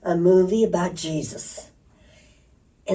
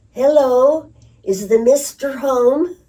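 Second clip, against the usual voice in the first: higher, louder, bright hello of a visiting pastor; cheerful preacher greeting; 7 semitones higher, 6 dB louder than usual. Retold or imitated. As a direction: call out politely at the door. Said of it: "said to my mother".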